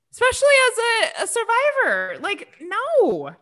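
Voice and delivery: high-pitched, worked-up indignation; angry, emphatic refusal; real anger. Real, not acted. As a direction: voice jumps up in exasperated protest. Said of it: "It makes me so angry".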